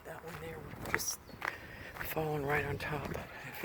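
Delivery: soft hushed early-morning whisper. Real, not acted. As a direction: keep it down, people asleep. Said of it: "I'm whispering because".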